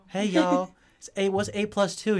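sweet Southern math teacher teaching class. Retold or imitated. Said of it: "She had a Southern accent".

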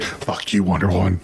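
Batman. Acted. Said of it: "Even at that age".

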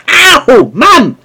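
hammed-up whiny ow mum; pained yelp. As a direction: yelp like a smacked son.

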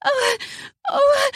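high, short whimpers. Acted. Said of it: "and she whimpered".

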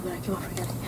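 sheepish half whisper; whispered aside. Real, not acted. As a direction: mutter it under your breath, embarrassed. Embarrassed at forgetting a name.

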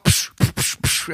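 unvoiced puffs imitating camera flashes; flashbulb pops. Co-host imagining press cameras, imitated flash noises. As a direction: puff out a burst of flashbulbs. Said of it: "Did flashbulbs go off?".

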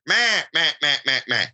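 imitated quacks, first one highest and then falling; duck greeting call. Shown as a demonstration. Hunter showing how ducks greet each other, imitated duck call. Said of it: "greeting call".

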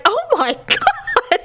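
high, drawn-out mock shock with a laugh; shocked husband. Husband sees his wife get up, shocked. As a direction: imitate an appalled husband, high and slow. Husband reacting to her injury.

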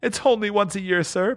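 high, meek clerk's plea; pleading. Acted. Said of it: "pleaded Bob".

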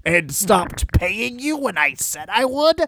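high, slow indignant promoter; Paul Heyman. Acted. Promoter complains to TNN about his contract, aggrieved and high. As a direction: whine the grievance upward, drawn out. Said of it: "gets as angry as Paul Heyman".

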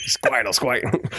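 squawky impression of an actor as a cartoon creature; Joe Pesci. Panelist demonstrates his casting pick, in a comic impression. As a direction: put on the actor's voice for the creature's name. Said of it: "I want Joe Pesci to play Squirtle".

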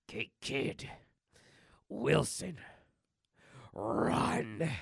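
slow, halting warning through rage; seething Wolverine. Acted. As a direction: hold the fury in, word by word. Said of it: "he is beyond pissed at this point".

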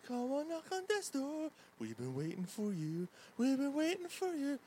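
high eerie ghostly voice; spooky haunted house moan; haunted house actor. Pretend. Spooky death's door chant.

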